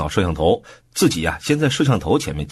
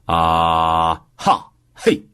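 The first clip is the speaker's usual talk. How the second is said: held "啊", then short punchy "哈", "嘿"; demonstrated exclamations; 2 semitones lower, much slower than usual. Shown as a demonstration. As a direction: call out vocal-exercise syllables firmly. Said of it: "同时大声说". (示范发声喊出感叹词)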